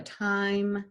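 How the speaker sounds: usual speaking voice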